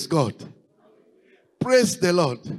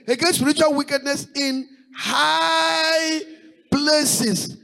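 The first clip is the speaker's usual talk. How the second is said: preaching; 7 semitones higher than usual. Acted. Pastor proclaiming Ephesians six to his church, fervent preaching. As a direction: proclaim the verses with fire.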